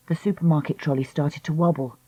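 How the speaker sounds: ordinary delivery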